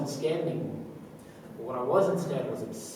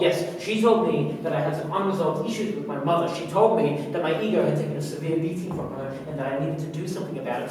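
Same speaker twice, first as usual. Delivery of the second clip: louder, higher, insistent protest; agitated self-defence; 4 semitones higher, 5 dB louder than usual. Acted. Blaming the psych person for what happened, agitated self-defence. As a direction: push the excuse out forcefully after faltering starts.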